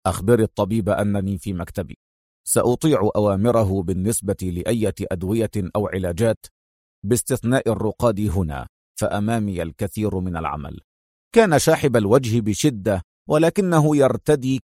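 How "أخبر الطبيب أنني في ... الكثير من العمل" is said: low, softer firm man's voice; stern orders. A narrator's reading. Adham tells the nurse his terms, low and stern. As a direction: give orders curtly. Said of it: "ويقول في صرامة".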